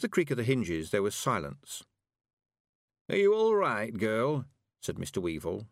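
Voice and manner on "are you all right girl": put-on higher, louder old man's question; concerned old countryman. A narrator's reading. Mister Weevil asking the silent girl, concerned.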